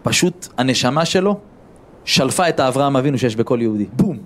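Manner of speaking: normal talking voice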